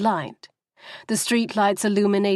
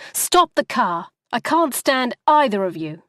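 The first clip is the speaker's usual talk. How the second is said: louder, higher angry shout; furious demand; 4 semitones higher, 4 dB louder than usual. Acted. Wife yelling at taxi driver to stop, loud and angry. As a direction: order the car to stop, fed up.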